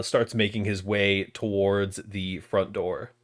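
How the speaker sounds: normal talking voice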